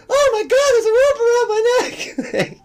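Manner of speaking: very high, loud hammed-up shriek; mocking a man shrieking about a rope; mock panicked scream. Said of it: "Like, screaming it out".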